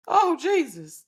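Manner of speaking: high loud mock cry of Jesus; mock sex cry. Imitating a woman in bed calling out to Jesus, high and loud. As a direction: wail it like you're mid-climax. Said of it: "Don't act like y'all ain't".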